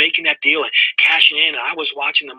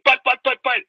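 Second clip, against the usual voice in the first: sputtering objection; 10 semitones higher than usual. Retold or imitated. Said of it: "I'm like".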